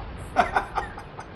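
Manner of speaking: soft unvoiced chuckle. Commentator amused by a kill, chuckling softly. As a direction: chuckle quietly to yourself.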